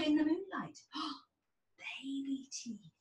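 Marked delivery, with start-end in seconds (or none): 0.9-1.3 gasp. A narrator's reading. Narrator gasps at sparkle. 1.8-2.9 put-on slow, soft breathy reveal of baby teeth; awed whisper. A narrator's reading. Tired fairy revealing baby teeth to the queen, whispered in awe.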